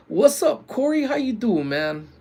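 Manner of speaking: high-pitched, loud hello; excited greeting. Real, not acted. Streamer greets a friend in the chat, excited greeting. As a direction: greet a buddy in a big high voice. Greeting a viewer who joined.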